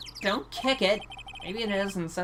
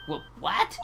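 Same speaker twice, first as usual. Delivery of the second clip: shocked what. Acted. Shocked to hear lawyer.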